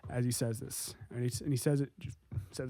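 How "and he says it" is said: usual speaking voice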